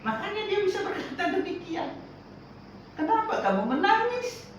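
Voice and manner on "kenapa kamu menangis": Jesus asking. Acted. Quoting Jesus to mourners.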